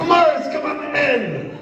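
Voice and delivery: high, drawn-out welcoming call; slow, high-pitched welcome; calling him in. Acted. Guard welcomes a vouched-for visitor in.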